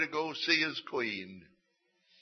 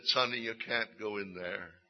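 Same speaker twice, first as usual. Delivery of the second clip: put-on softer, lower guard consoling a fallen boy; gentler guard; 6 semitones lower, 6 dB quieter than usual. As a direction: ease off, kind but still firm. Guard picks up crying boy.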